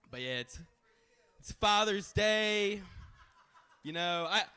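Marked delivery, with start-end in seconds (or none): none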